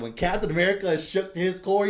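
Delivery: gushing fan building up; excited. Retold or imitated. Fan reliving the comic's reveal, excited. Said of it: "I was like".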